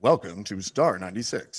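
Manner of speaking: low-pitched radio station welcome; playful low announcer ident; mock radio announcer. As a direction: put on a deep DJ voice.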